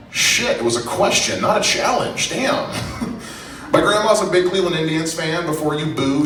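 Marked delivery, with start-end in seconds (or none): none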